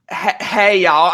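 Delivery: badly faked country accent; hesitant, hammy go at a country accent; fake country accent. Shown as a demonstration. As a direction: attempt a country twang and fumble it. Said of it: "fake a country accent".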